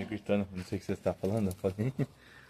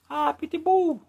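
mocking whine; much slower than usual. Mocking people.

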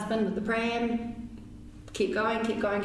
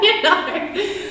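laughing; 12 semitones higher, 8 dB louder than usual. Real, not acted. Laughing about husband's rocks in head.

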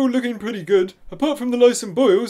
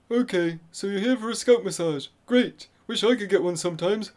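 ordinary talk

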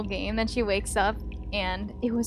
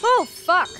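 startled yelp; 7 semitones higher, 6 dB louder than usual. Real, not acted. Startled by a jump scare.

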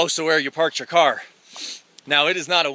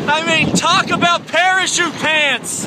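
shouting over wind; 11 semitones higher, 4 dB louder, slower than usual. Real, not acted. Clothes flapping in gale.

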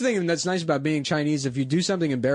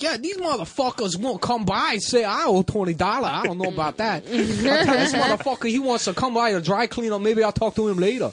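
put-on higher voice, heavy Cambodian accent; 8 semitones higher than usual. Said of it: "That's a Cambodian accent".

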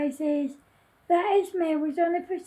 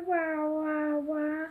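drawn-out, spoken wah-wah wail; mock crying; 4 dB quieter, slower than usual. Grumpy heartbroken over Esme, mock crying. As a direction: fake a cry on long, level wahs. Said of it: "cried Grumpy".

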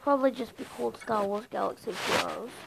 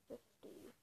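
whispered; 26 dB quieter than usual. Real, not acted. Drops to a whisper.

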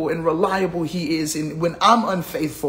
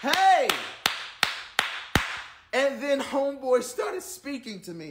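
ordinary talk

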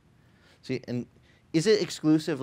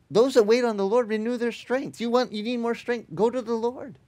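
higher, louder preaching push; 8 semitones higher, 3 dB louder than usual. Acted. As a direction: urge the congregation forward. Rising preaching exhortation.

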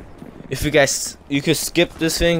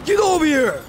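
angry guard's yell. Retold or imitated. Security guard calling out a kid at the basketball courts, yelling. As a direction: yell it sharply across the court.

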